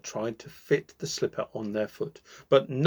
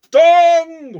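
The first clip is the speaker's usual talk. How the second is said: long high loud bong; clock chime; 16 dB louder than usual. A narrator's reading. Clock striking midnight, a long loud bong. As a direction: ring it out like a big clock bell.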